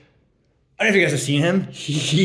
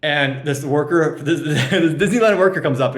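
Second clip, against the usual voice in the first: ordinary talk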